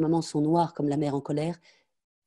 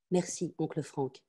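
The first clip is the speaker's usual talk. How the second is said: softer, slow small thank-you; cowed child; 5 dB quieter than usual. Acted. Frightened girl repeating her thanks to her uncle, soft and slow. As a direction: mumble it out, defeated.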